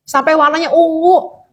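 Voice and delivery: high, wailing remorseful mother; mock-weeping, pitched high all through; imitated tearful lament. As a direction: act out a mother crying over her child. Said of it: "Dia nangis, sedih gitu".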